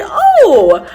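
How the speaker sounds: high, cheery imitation of a Minnesotan accent; playful Minnesotan mentor impression; cute Minnesotan accent. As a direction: lilt it up high with Minnesotan vowels. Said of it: "has this cute little Minnesotan accent".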